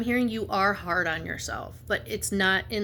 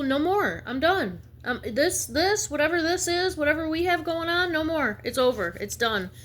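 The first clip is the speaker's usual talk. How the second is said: higher and louder, putting your foot down; firm telling-off; 9 semitones higher, 3 dB louder than usual. Retold or imitated. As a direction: tell them it's over, high and firm. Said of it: "And tell people".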